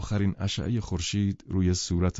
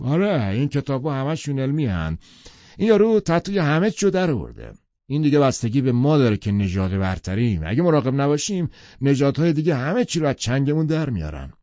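higher, louder blustering man; Tom's bragging voice; 6 semitones higher, 5 dB louder than usual. Acted. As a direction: boast it like a proud bully. Tom voiced in read novel. (صدای بلند و پرمدعای تام)

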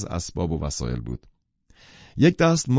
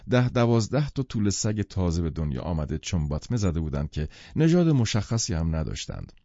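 ordinary delivery, nothing marked

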